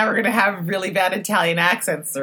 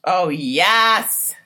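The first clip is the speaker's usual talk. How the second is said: excited; 4 semitones higher, 8 dB louder than usual. Real, not acted. Excited reaction to show.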